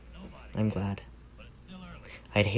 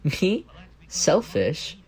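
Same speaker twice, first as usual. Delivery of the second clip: mock offended; 7 semitones higher, 5 dB louder, much slower than usual. Teased about wanting the ice cream.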